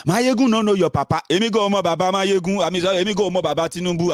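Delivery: loud, high-pitched taunting; mock indignant yelling; mimicked angry outburst. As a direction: shout it like an outraged supporter. Mimicking angry defenders shouting.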